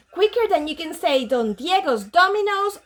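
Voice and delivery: ordinary delivery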